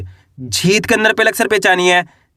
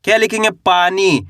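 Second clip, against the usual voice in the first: normal talking voice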